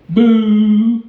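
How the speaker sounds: loud playful boo; mock boo. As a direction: boo them like a crowd. Mock booing the racists.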